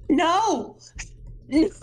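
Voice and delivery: high, stricken denial; dismayed. Retold or imitated. Reader suddenly remembering the boat's fate, dismayed. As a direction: let the dread land, voice lifting high. Retells sudden painful realisation.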